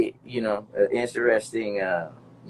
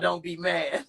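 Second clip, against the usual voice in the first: laughing quote; 3 dB quieter, slower than usual. Retold or imitated. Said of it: "Talking about".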